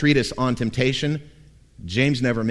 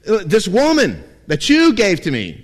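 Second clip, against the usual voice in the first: flustered Adam; 7 semitones higher, 6 dB louder than usual. Retold or imitated. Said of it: "And so he says".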